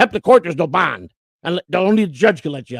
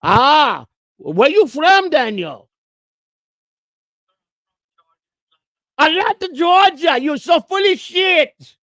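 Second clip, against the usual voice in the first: mocking scam caller; 8 semitones higher, slower than usual. Real, not acted.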